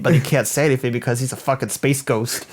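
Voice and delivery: usual speaking voice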